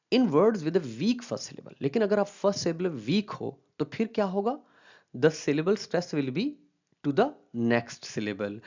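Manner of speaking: slow, careful textbook reading; reading a rule aloud. Acted. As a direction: read the rule out slowly, word by word. Reads rule from slide slowly. (धीमी आवाज़ में नियम पढ़ना)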